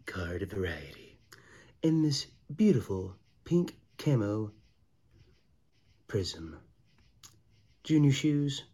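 slick pitchman hyping the card; used car salesman voice. Acted. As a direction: sell it like a car lot dealer. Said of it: "stop the used car, car salesman voice for you".

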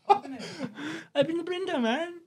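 very high, slow laughing squeal; words squeezed out through laughter; helpless high laugh-talk. Real, not acted. Repeats it while laughing.

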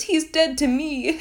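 high, slow, hammed-up declaration; mock melodrama. Son disowns his father to crewmates, mock melodramatic. Character's dramatic line mocked.

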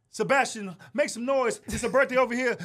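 high, punchy crowd warm-up; imitating hype comics. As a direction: work the crowd like an eager stage comic. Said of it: "everybody come on stage and be like".

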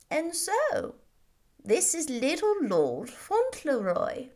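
slow, measured, deliberate; slow old gentleman. A narrator's reading. Old earl studies the boy, slow and measured. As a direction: weigh each word as he studies the boy.

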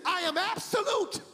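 high, ringing proclamation; emphatic divine declaration. Retold or imitated. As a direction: proclaim it forcefully as God's word. God's words repeated with force.

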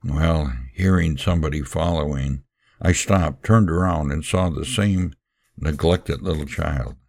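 plain reading voice

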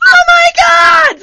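shrieking high and loud in panic; a sudden shrieked oh my God; panicked shriek. Retold or imitated. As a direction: scream it out in alarm. Panicked reaction to dog attack.

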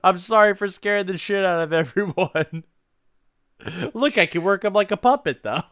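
high, giggly sheepish announcement; mock apologetic sheriff. Sheriff to the scared town, a high giggly mock apology. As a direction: apologize brightly while holding the head. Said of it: "He's like".

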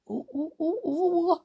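high, excited string of oohs. Real, not acted. Reader teasing listeners about the next chapter, excited oohs. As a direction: ooh with rising anticipation.

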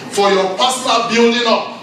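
high-pitched, insistent sermon delivery; urgent exhortation, pitch held high; impassioned preaching. Real, not acted. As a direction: drive each phrase home to the congregation. Preaches at raised intensity.

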